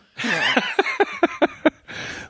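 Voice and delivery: a high, squealing laugh. Real, not acted. Reacting to the dog water story with a high squealing laugh. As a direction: shriek with laughter.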